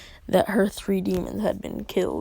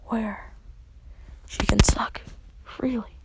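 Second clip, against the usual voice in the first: ordinary delivery, nothing marked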